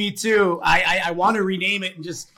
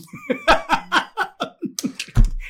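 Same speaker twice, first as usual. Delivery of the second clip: big laugh. Real, not acted. After telling the meme joke.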